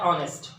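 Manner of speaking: "ऑनेस्ट" starting on the vowel "o"; silent h. Shown as a demonstration. Teacher showing how honest sounds, "ऑनेस्ट" without h. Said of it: "इसका sound होता है".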